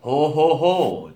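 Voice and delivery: loud booming Santa laugh; hearty belly laugh; jolly laugh. Acted.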